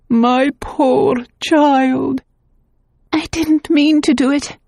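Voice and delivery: tender then pleading; pitying remembered voices. Acted. Voices from the past lamenting, high and pleading.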